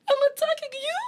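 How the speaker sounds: very high disbelieving retort that dissolves into laughter; incredulous laugh. Retold or imitated. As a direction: toss it back in shock, then crack up laughing. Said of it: "I'm like".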